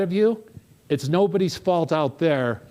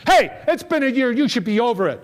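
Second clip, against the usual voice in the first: mock outburst, higher and a bit louder; imitating angry cab driver; 7 semitones higher, 3 dB louder than usual. Cab driver snapping at his passenger after slamming the brakes, mock angry. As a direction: snap it like a triggered cabbie. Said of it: "and, and said this".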